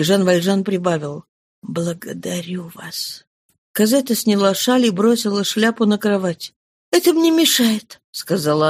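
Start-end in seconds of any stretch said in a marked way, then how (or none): 1.6-3.2 very soft, slow thanks; barely audible; faint. Acted. Old man's faint thanks. 6.9-8.0 put-on higher, light girl's voice; lightly, like a young girl. Cosette's light remark.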